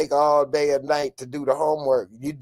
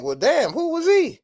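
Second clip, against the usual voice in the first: high, eager question of sudden interest; curious surprise; 12 semitones higher than usual. Pretend. He shows how he'd answer, high and keen. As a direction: ask it like you truly want to learn. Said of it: "I'll be like".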